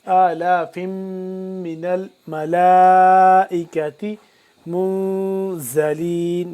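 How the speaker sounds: الملائکۃ with the la stretched four to five alifs; long madd. Shown as a demonstration. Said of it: "لمحہ کریں گے چار سے پانچ الف کے برابر".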